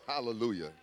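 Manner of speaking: usual speaking voice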